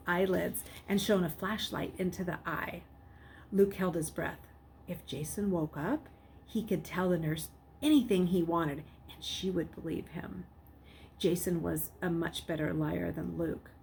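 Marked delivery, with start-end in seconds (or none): none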